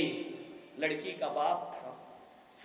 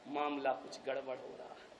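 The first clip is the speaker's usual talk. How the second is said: worried murmur; 6 semitones lower, 12 dB quieter than usual. Acted. Father worried aside.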